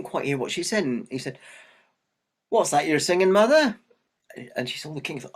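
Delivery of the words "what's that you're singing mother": louder, higher, rising at the end; calling out question. Acted. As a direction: call it out to someone down the road. King calls out to the mother.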